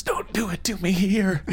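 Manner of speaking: high, airy stage whisper; mock whisper. Co-host imitating the beardy guy, mock whisper. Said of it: "And then the guy whispers".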